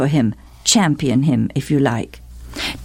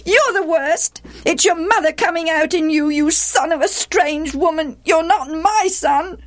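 high-pitched bitter stepmother outburst; overwrought, bitter. A narrator's reading. Stepmother rounding on the quarrelling boys, overwrought and bitter. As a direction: let a shrill-pitched, overwrought scolding pour out. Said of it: "Gilead's wife was overwrought, and with bitterness".

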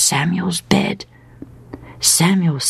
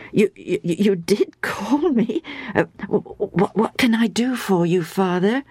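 put-on nervous stammering boy; 3 semitones higher than usual. Samuel stammering to Eli in his room, mildly nervous. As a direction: stutter it out, a touch flustered.

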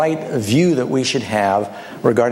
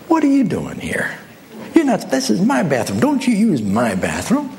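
a lightly voiced cranky grandpa, higher; mild grumpy old man scolding a guest; 6 semitones higher than usual. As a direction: snap at the intruder like an old crank. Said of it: "this grumpy old man".

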